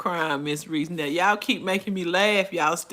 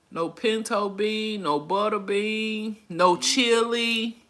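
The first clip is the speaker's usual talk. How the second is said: ordinary talk, nothing marked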